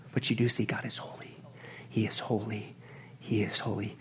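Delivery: hushed whisper, he is holy. Shown as a demonstration. Preacher whispering God's holiness to the congregation. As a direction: breathe it out in awe. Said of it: "only attribute mentioned three times".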